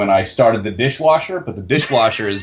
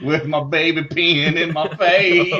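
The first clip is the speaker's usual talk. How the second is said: mock wife voice; 6 semitones higher, slower than usual. Mocking the wife's voice.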